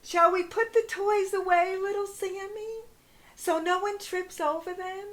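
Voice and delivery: high, sugary wake-up coo; mock gentle parent; exaggerated gentle parent. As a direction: coax a child out of bed too sweetly. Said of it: "let's do the next one".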